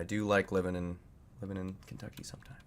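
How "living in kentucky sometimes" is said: quick unvoiced whisper. Real, not acted. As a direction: whisper it under your breath. Whispered lines.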